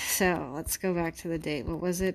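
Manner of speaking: ordinary talk, nothing marked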